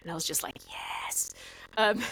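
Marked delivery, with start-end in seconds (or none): 0.7-1.3 whispered, voiceless little exclamation; whispered yes. Retold or imitated. Recalling finding the old camera in the dream, whispered triumph. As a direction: whisper it as a private reaction. Retold private moment of triumph.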